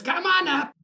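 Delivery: high sing-out imitation; retold calling out. Old lady calls visitor up to her apartment, high mock call.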